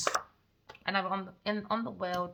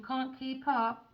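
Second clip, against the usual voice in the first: ordinary talk, nothing marked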